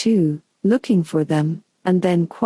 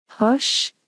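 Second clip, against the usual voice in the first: low hurried hush; 6 semitones higher than usual. A narrator's reading. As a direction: hush her quickly, a squeak of alarm. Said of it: "in a low, hurried tone".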